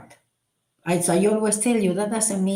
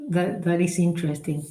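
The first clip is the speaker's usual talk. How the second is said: ordinary talk, nothing marked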